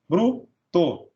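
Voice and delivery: "bruto" broken as bru, pause, to; split into syllables. Shown as a demonstration. Teacher dividing "bruto" into bru and to, syllable by syllable. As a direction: keep bru together in "bruto", then a separate to. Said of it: "Vem junto a consoante L ou R".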